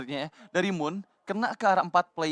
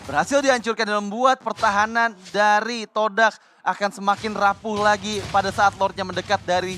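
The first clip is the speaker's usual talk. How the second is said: loud booming play call; hype commentary; 3 semitones higher, 6 dB louder than usual. Real, not acted. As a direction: boom it out over the crowd. Co-caster calls play loudly.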